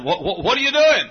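retold startled stammer on "what"; startled question. Preacher questions the maid in the bathroom, startled.

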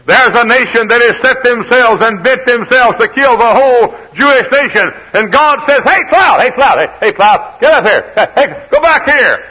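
high, loud pulpit cry; full-force preaching shout. Acted. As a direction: shout it like God ordering a cloud about. Preacher at full force, God commanding.

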